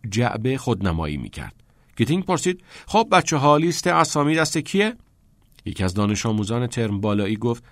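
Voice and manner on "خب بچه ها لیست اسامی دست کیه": calling out. A narrator's reading. Keating calls out on the field.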